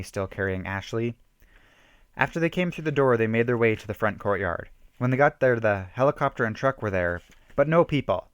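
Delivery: plain reading voice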